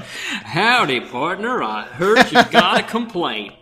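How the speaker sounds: loud, high Texan drawl; hammy cowboy twang. Pretend. Cowboy voice for call center.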